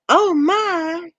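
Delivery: high drawn-out Southern "Oh my"; Southern drawl. Shown as a demonstration. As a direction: stretch "Oh my" in a syrupy Southern drawl. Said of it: "with that Southern drawn out".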